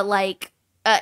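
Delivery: ordinary talk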